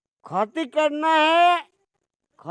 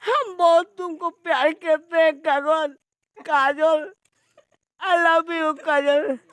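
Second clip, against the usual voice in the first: crying love plea; 5 semitones higher than usual. Acted. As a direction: beg Kajal through sobs. (काजल को रोते हुए पुकारना)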